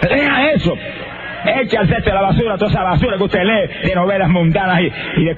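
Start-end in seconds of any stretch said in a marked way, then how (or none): 0.1-2.8 higher, urgent preacher's exhortation with shouted peaks; preaching at full force. Acted. Preacher exhorts the congregation to buy a Bible, fervently. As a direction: urge the crowd to read the Bible, rising to a cry.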